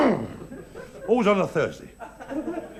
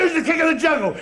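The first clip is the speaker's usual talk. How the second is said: high, insistent bullying question; angry lion demand; 9 semitones higher than usual. Acted. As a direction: demand an answer from someone who is laughing. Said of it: "He was really mad".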